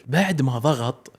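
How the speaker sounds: ordinary delivery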